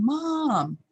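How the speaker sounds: high, drawn call for Mom; imitated kids' yelling. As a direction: mimic kids calling out for their mother. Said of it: "all of us kids were yelling".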